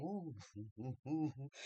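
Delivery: low, soft oohs; hushed onlooker oohs. Retold or imitated. Onlookers watch the child's tantrum, hushed oohs. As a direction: murmur a string of judging oohs.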